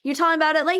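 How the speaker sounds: usual speaking voice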